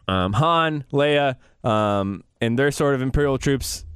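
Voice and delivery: ordinary talk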